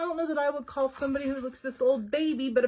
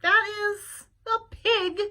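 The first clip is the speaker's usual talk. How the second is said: scornful correction; 8 semitones higher, 5 dB louder, slower than usual. Acted. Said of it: "said Eugenia".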